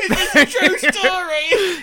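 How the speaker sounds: squeaky, slow, laughing disbelief; high laughing squeal of a question. Real, not acted. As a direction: ask it through helpless laughter. Cohost cracking up at joke.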